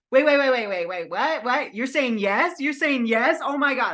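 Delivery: high, loud, rapid disbelief; can't believe they said yes; disbelieving surprise. Retold or imitated. Author hears a bookstore say yes, excited and disbelieving. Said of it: "it's almost like it surprised me".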